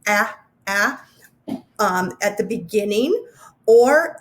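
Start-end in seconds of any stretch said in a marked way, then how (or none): 0.1-1.0 a, a — the short a vowel repeated alone; short a sound. Shown as a demonstration. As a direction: isolate the short a. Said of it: "words that have A".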